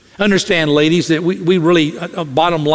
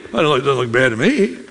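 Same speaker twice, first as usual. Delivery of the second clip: breezy dismissive husband. Retold or imitated. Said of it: "husband's breezy reply".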